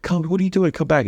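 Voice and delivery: high-pitched, sing-song coaxing; coaxing call to baby. Retold or imitated. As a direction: parents imitated calling the baby back to them, coaxing high voice. Parents calling crying baby back.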